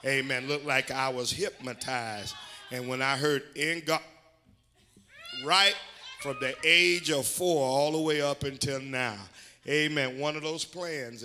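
punchy, loud church testimony; fired-up preaching. Real, not acted. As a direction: testify with rising force. Preaches with raised force.

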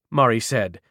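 ordinary delivery, nothing marked

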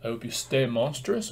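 ordinary talk, nothing marked

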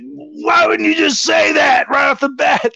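high, drawn-out indignant yell; retold exasperated outburst. Host to confessing houseguest, retold exasperated outburst. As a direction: wail it high in exasperated disbelief.

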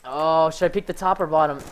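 ordinary delivery

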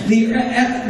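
normal talking voice

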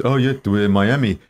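light Israeli accent, dad imitation; a hint of a thick Israeli accent. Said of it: "with his thick Israeli accent".

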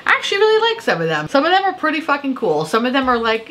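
bright excited gush. Real, not acted. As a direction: gush about the haul with delight. Delighted by her purchases.

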